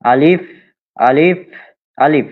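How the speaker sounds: measured recitation of Arabic letters, each said three times. Shown as a demonstration. Teacher drilling the alphabet for online students, clear recitation.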